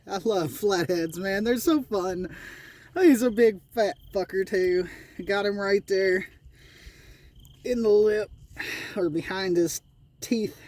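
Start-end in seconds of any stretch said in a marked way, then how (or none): none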